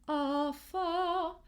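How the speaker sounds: British "are far" with the R's dropped; British accent. Shown as a demonstration. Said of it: "In a British accent, we might hear".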